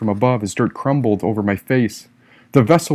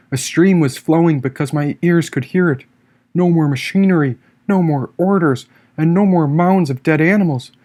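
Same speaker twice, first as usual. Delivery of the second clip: plain reading voice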